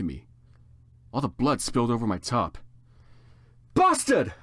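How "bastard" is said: high, loud angry shout. Acted. Heroine shouting at the stranger who spilled blood, high angry shout. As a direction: spit the insult out. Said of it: "I shouted angrily".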